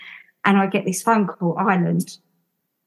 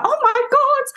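very high, amazed squeal of words; squeaky mock disbelief; retold astonishment. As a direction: relive the shock, pitched right up. Reacting to the printed will.

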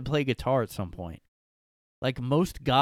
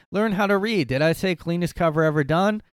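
raised, higher voice, defending hard; loud mock indignation; 7 semitones higher, 7 dB louder than usual. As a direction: read it like an offended fan. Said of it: "Jay responded".

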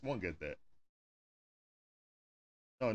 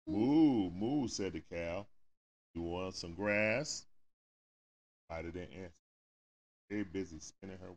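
soft, low storybook reading; reading to a child; 8 semitones lower, 6 dB quieter than usual. Acted. Parent reading a picture book aloud to a child, softly. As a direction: read gently to the little one at bedtime.

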